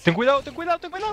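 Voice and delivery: high panicked warning; alarmed warning. Real, not acted. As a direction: squeal it out in alarm. Enemy gas attacking.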